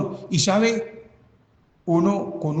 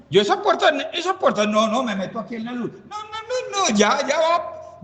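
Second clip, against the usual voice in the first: laughing mock choice; 12 semitones higher than usual. Imita su elección, riendo.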